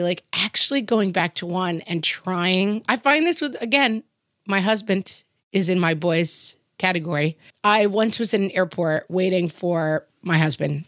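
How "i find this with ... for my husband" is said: ordinary talk, nothing marked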